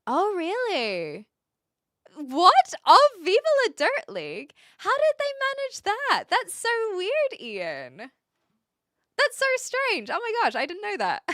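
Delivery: put-on bubbly high Valley Girl gush; Valley Girl accent. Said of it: "I don't wanna do my Valley Girl accent".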